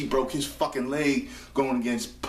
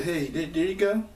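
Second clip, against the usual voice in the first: Jericho voice; 8 semitones higher than usual. Retold or imitated. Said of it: "So Jericho said".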